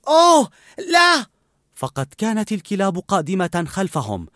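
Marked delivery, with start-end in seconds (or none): 0.1-1.3 high, loud cry of alarm; boy cries out in horror. A narrator's reading. Boy sees dogs closing in, loud cry. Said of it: "صرخ راسل".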